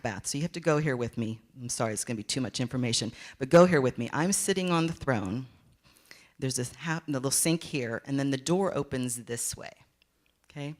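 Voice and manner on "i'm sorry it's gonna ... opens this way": ordinary talk, nothing marked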